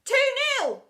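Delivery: high, loud playground call. A narrator's reading. Kid calls an imaginary score, calling out. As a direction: sing it out across the playground. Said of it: "called out one kid".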